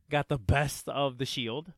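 normal talking voice

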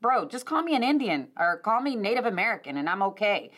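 usual speaking voice

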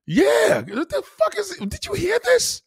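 high-pitched disbelief; incredulous. Real, not acted. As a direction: squeal it in disbelief. Incredulous reaction.

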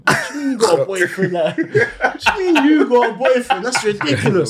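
high, laughing mock outrage; laughing disbelief. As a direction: act shocked through laughter.

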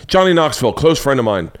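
normal talking voice

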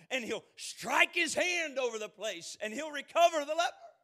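loud, high, indignant rant; angry Naaman. Acted. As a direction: let his wounded pride boil over. Naaman voiced in his anger.

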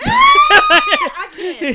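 high shrieking laugh. Real, not acted. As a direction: squeal it while cracking up. Overwhelmed by laughter.

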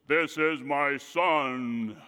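booming voice of God. Pretend. Preacher voicing God the Father at the baptism, lightly booming. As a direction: intone it slowly like a mild booming voice from heaven. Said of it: "the Father says with his loud, booming voice".

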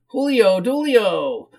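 high whiny call of a name; whiny woman. Acted. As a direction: whine his name out as a greeting. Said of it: "she said in a whiny voice".

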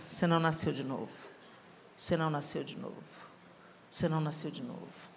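a voice haunting the mind; echoing in my head. Retold or imitated. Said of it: "ficou latejando na minha cabeça".

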